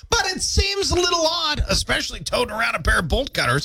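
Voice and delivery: high-pitched mock-incredulous yelp; incredulous outburst. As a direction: squeal it as a comic objection. Mock outburst over odd sight.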